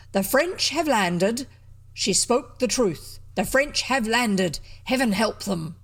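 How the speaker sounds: loud, deliberate, solemn announcement; grave proclamation. Acted. Lord Arden to the crowd as ship wrecks, loud proclamation. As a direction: proclaim it slowly to a whole crowd.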